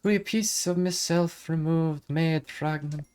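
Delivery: high lilting Irish fairy voice; Irish accent. Acted. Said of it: "let's hope I can remember how to do the Irish accent".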